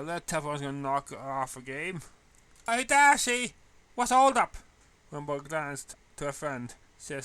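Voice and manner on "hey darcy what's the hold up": loud, high call across the hall. Acted. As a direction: shout cheerfully to a friend. Calling out to friend.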